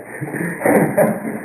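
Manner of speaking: breathy chuckle; short laugh. Real, not acted. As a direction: laugh at the irony. Laughing at his own punchline.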